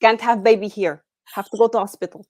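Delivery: put-on insistent Russian-accented movie line. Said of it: "a Russian accent".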